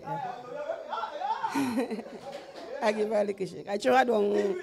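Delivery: high, loud laughing confession. Real, not acted. Laughing while telling Brother Albert of the shake. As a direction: laugh through the admission.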